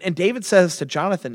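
usual speaking voice

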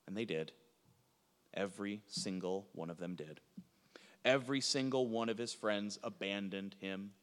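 low, hushed and sombre; soft, weighty verdict; grave preaching. Real, not acted. Preacher concludes that every friend abandoned Jesus, hushed and grave.

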